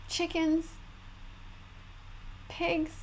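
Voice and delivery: usual speaking voice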